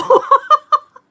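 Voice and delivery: sudden high shrieking laugh; burst of laughter. Real, not acted. Reading chat, bursts into shrieking laughter. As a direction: crack up at the chat.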